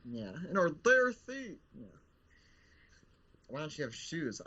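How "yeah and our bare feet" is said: whiny zombie. Acted. Puppet Bill complains.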